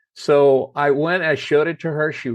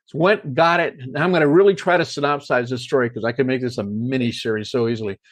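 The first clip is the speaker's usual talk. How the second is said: usual speaking voice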